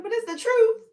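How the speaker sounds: put-on very high, loud childish squeal; exaggerated loud kid insistence. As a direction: protest shrilly like a child. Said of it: "This is how kids talk".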